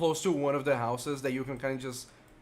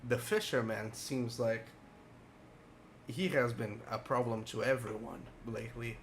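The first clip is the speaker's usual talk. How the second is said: put-on very old man; 3 semitones lower, 6 dB quieter than usual. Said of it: "a very frail old man".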